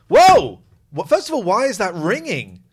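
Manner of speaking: high, loud startled outburst. Real, not acted. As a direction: jolt up at the sudden noise. Sudden ringing startles him.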